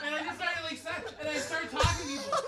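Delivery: ordinary delivery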